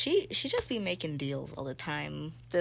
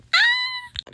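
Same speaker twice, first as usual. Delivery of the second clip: a high, loud scream of frustration; frustrated scream; 12 dB louder than usual. Real, not acted. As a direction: let out a sudden shriek. Frustrated outburst while reading.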